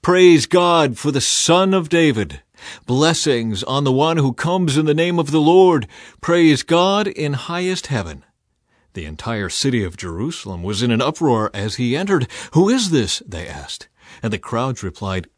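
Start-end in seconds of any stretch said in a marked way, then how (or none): 0.0-8.2 loud, high jubilant acclaim; crowd shouting praise. A narrator's reading. Crowd around Jesus in the procession, shouting praise. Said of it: "the people all around him were shouting". 12.5-13.2 loud, high question; excited crowd question. A narrator's reading. City in uproar as Jesus enters, loud high question.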